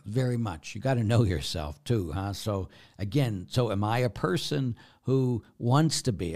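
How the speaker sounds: normal talking voice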